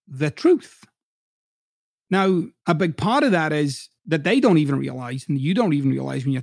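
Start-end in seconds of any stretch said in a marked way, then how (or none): 0.1-0.8 air quotes. Shown as a demonstration. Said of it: "in quotes".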